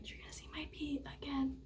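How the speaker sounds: quick, breathy whisper to the camera. Real, not acted. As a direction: whisper it fast, a little giddy. Teasing viewers while starting the test.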